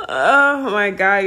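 high laugh into a slow oh my God; laugh, then sigh it out. Real, not acted. Laughing at her own booty rub wish.

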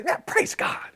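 hushed, high, airy toss-off; casual church praise. Acted. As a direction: imitate a light, automatic praise. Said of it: "You know how we can say".

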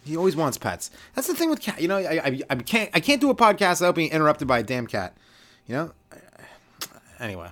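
ordinary talk

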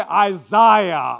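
Isaiah said as eye-ZYE-uh, second syllable like "eye"; Isaiah with the middle syllable rhyming with "my"; Irish way. Shown as a demonstration. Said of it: "If you were Irish".

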